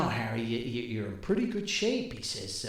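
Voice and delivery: normal talking voice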